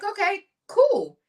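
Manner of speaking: high, slow, easygoing okay; nephew's easy okay. Retold or imitated. Nephew accepting the owner's free months, high and relaxed. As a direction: let it drift up, relaxed and pleased.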